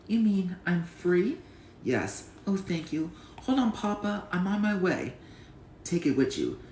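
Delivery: child acting out all the film's characters by heart; a child's dramatic movie recitation; reciting a film scene. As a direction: play every character from memory.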